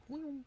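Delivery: high whistled "whew" of a bullet; high whizzing sound effect; imitated bullet whistle. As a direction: mimic a shot flying past, high-pitched. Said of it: "I swear I heard it, it was like".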